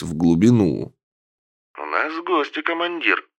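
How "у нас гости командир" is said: tinny, quiet radio voice; as if heard through a headset speaker; voice through earpiece. A narrator's reading. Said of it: "В наушнике зашелестел голос".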